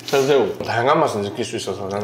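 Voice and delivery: speaking between bites; talking while chewing. Real, not acted. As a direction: mouth partly full of food. Seen 0: Eats with chopsticks at start, then talks with lips pursed, chewing.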